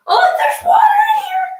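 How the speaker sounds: high shocked cry; shocked shout. Real, not acted. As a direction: yell it in sudden alarm. Just fell into wet tub.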